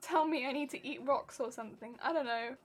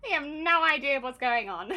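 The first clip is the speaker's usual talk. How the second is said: ordinary talk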